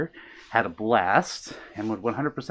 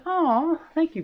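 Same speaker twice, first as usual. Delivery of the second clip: touched aw; slower than usual. Real, not acted. Touched by viewer comment.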